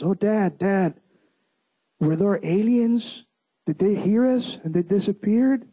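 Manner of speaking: retold anxious questioning, voice lifted; worried daughter. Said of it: "My daughter got worried".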